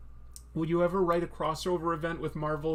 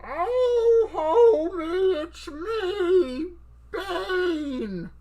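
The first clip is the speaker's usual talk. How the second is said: very high, loud, strained falsetto; drawn-out screechy female impression; Marge Simpson doing Bane; 13 semitones higher, 8 dB louder, much slower than usual. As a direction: wail it as a cartoon wife playing a villain. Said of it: "Marge Simpson doing an impression of Bane".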